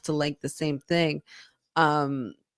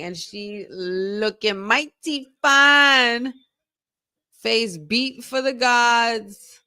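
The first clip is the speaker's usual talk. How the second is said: high, slow, drawn-out showcase; announcer hype; 6 semitones higher, much slower than usual. Acted. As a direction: present her like a red carpet. Hyping champion's look.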